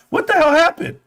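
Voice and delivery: what happened, shrieked high; high exasperated cry. Real, not acted. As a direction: jump way up in pitch, exasperated. Outraged exclamation.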